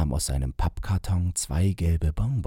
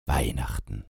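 grumbled; 4 semitones lower than usual. A narrator's reading. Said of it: "brummte er".

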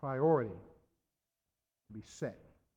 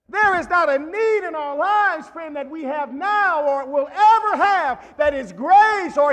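full-force preaching; 8 semitones higher, 12 dB louder than usual. Real, not acted. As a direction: preach it out to the church.

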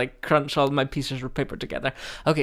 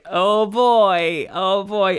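mock dismay; 4 semitones higher, 5 dB louder, much slower than usual. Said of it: "And I'm just like".

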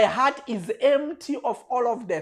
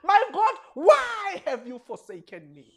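higher cry fading back down; a hint of an anguished cry; 10 semitones higher than usual. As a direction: cry out high, then let it fall. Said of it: "I should be crying out those words".

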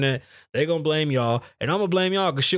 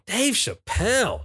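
announcing; 6 semitones higher, slower than usual. Real, not acted. Drawn, higher announce.

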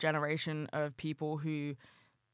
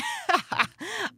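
high giggle; 3 dB louder than usual. Real, not acted. Laughs after excitement.

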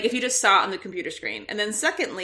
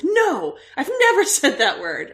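high, louder, laughing protest; appalled, laughing; 7 semitones higher, 5 dB louder than usual. Retold or imitated. Appalled son denying he ever said it, laughing. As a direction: laugh through a shocked denial.

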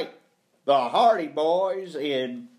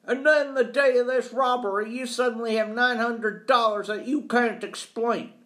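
put-on high, forceful, scornful old employer; scornful accuser; 9 semitones higher than usual. Employer doubts caretaker's honest money, scornful. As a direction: pitch it up and pour on disbelief.

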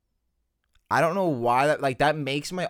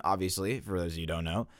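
normal talking voice

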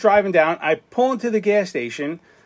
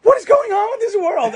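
high, pleading disbelief; high, exasperated plea to the world; exasperated wail. Retold or imitated. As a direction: cry out to the heavens in exasperation. Despairing cry in the car.

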